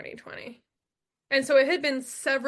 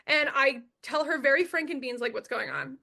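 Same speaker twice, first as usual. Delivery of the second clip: usual speaking voice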